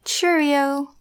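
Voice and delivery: Cheerio said clearly and high; repeated word. Shown as a demonstration. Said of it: "What's cheerio?".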